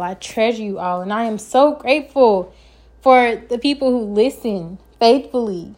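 ordinary talk

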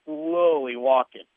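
"slowly" stretched long to mimic the pace; drawn-out slowly. Real, not acted. Telling of the giant's walk, "slowly" drawn out. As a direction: drag out the word "slowly".

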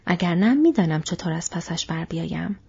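ordinary delivery, nothing marked

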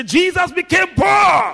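loud, high rallying shout; with guts. Shown as a demonstration. Preacher urging the congregation to repeat it, gutsy shout. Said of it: "say it with some guts".

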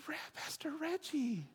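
playful breathless whisper to Pastor Reggie; stage whisper. Real, not acted. Pastor teasingly whispers to a colleague across the stage. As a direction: whisper it like a shared secret joke.

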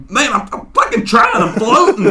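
imitating a flustered teammate, high and loud; upset. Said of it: "Bone starts getting upset".

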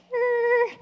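high-pitched skidding-halt noise; mock screech. Preacher playing Elijah balking at Jezebel's threat, mock screech. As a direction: screech to a comic stop. Prophet balks at death threat.

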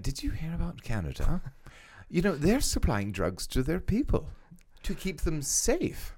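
mock British gossip over tea; imitating a British person in a high British accent. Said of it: "I love the British accent".

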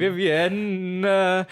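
stretched, sung-out name; playful. Pretend. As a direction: belt the name like a song hook.

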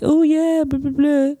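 high, sing-song mock of a smug kid; mocking dismissive classmate. Imitating the spoiler kid, high and mocking. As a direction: toss it off like a smug brat. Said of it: "And he was like".

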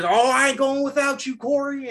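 high, put-on voice of a loyal buddy; imitating the partner. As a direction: play the partner, loyal and dramatic. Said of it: "He actually does talk like that".

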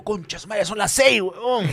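mock panicked realization. Panic hearing El Chavo at six.

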